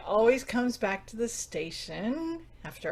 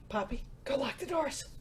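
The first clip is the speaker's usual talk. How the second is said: dropped to a quick softer aside; softer aside to the student, hidden from guests; hushed aside; 2 semitones higher, 7 dB quieter than usual. Acted. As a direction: slip the order out under your breath. Aside to Poppy mid-reassurance.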